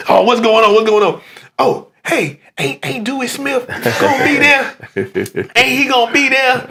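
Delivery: high-pitched imitation of an excited mother; eager mama. Son imitating his mama asking after the preacher, high and eager. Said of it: "my mama like him".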